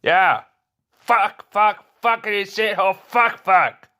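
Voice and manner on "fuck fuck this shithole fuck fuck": normal talking voice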